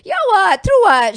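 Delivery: loud, insistent mom comeback; punchy retold Singlish retort; blunt retort. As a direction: imitate a mother insisting she's right.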